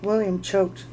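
ordinary delivery

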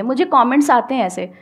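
ordinary delivery

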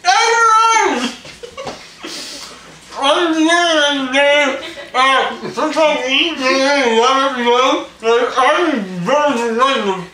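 high, loud, slow and garbled; loud mouth full shout. Real, not acted. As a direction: yell through stuffed cheeks.

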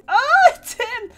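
high loud startled cry; startled shriek. Real, not acted. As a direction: squeal in surprise. Jump scare in game.

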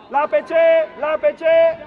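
loud call that carries downfield. Real, not acted. Coach pointing out a free teammate, loud call. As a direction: shout it up and out to the players.

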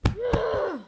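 loud high anguished no; frustrated shout. Real, not acted. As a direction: yell in defeat. Losing again.

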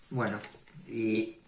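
usual speaking voice

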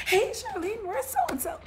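high, loud call-out to an acquaintance; mock loud, high-pitched hello; imitated nosy greeter. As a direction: pipe up high and loud, imitating someone asking after a friend. Voicing people greeting her.

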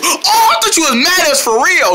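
ordinary talk

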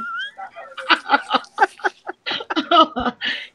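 long, very high-pitched laugh; real high, drawn-out peal of laughter; real laughing fit. Real, not acted. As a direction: crack up helplessly at your own story. Cracks up at own bird story.

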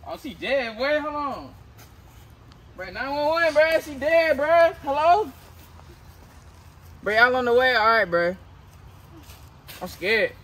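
loud frantic yelling; panicked shouting. Acted. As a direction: scream in panic at the body. Panicked discovery, calling 911.

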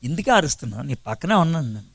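soft, low answer from close by; acted hushed, unruffled response; quiet reply. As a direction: answer the shouter softly and low. God voiced answering the shouter nearby.

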